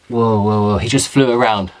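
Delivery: normal talking voice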